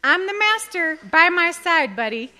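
firm owner. Retold or imitated. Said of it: "I'm like".

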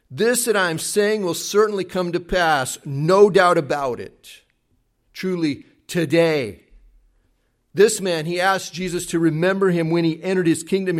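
usual speaking voice

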